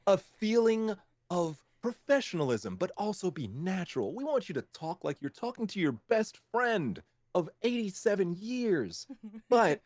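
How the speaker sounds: high, fussy client voice parodying vague directions; squeaky corporate client piling on demands; mock corporate client. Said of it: "when they're like".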